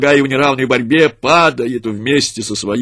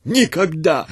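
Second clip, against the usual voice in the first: higher, sudden defiant cry; burst-out refusal; 6 semitones higher, slower than usual. A narrator's reading. Mother refusing to give up her child, high defiant cry. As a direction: let it burst out of you. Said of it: "вырвалось из её груди".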